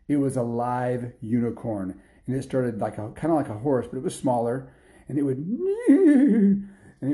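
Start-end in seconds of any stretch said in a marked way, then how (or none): 5.1-7.1 laughing through the words, higher and louder. Real, not acted. Delighted by her own story, laughing while telling it. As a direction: giggle as you describe it bucking.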